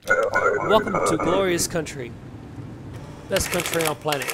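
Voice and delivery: loud, hammed-up official welcome; proud border officer. Plays border officer praising the country.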